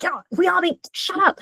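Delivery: high, snappish retort; annoyed pilot. Retold or imitated. Pilot snapping at his nervous co-pilot, high mock annoyance. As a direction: brush off the co-pilot impatiently. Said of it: "He's like".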